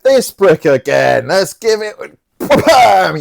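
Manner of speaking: loud, high mock battle cry; hammed-up brawler's shout; mock attack. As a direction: build to an exploding slap noise. Voicing attackers, miming the slap.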